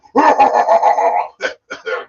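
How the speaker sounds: loud drawn-out villain cackle; big hammed-up monster laugh; evil laugh. Mock evil laugh, horror host.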